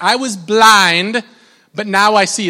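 loud, stretched-out "blind"; excited declaration. Shown as a demonstration. Preacher rousing church to get excited, emphatic declaration. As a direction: proclaim it with real conviction. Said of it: "are you guys excited about that?".

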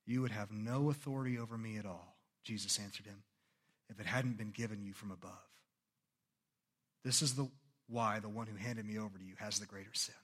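low, soft, steady reply; Jesus calmly answering Pilate, low and soft. A narrator's reading. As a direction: answer quietly with settled authority.

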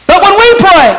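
high, loud pulpit preaching; fiery Sunday sermon; preaching at full force. Acted. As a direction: drive it home to the congregation.

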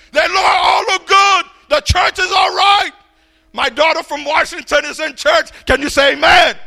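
preaching. Acted. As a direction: call the congregation to answer. Rousing the congregation.

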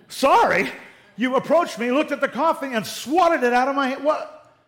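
high, loud, incredulous protest; indignant disbelief. Acted. Wronged coffee owner confronts the one who swatted it, indignant. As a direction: throw the apology back in outrage. Acted victim of deliberate coffee spill.

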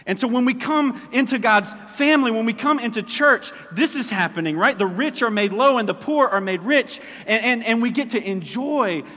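loud, high, ringing sermon voice; preaching at full force. Acted. Pastor stirring up his congregation, loud and high. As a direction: preach it out big to the room.

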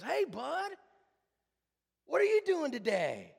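put-on high, loud voice for greeting a little kid; hammed-up barista greeting a small boy; chirpy greeting to child. Starbucks guy at the window to Brent, chirpy greeting.

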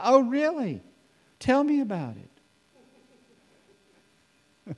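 high, feigned surprise; mock innocent interest. Jesus teasing the travelers, high mock surprise. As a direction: pretend you know nothing.

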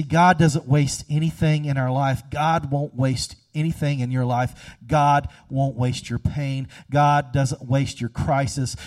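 normal talking voice

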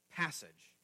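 ordinary talk

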